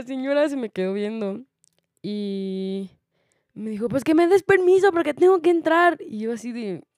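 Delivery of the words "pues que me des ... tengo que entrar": high, loud put-on voice of a pushy older lady; mock the entitled woman demanding to get in; imitating rude older lady, high and loud.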